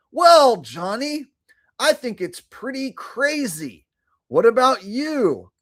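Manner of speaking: high, slow put-on cowboy voice; answering in a squeaky gunslinger voice; Doc Holliday impression. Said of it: "have a similar voice".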